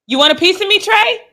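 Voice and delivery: high, mocking fan challenge; squeaky mock taunt to Trey; mock tough guy. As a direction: ham up the heckler's taunt. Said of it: "mocking the fan".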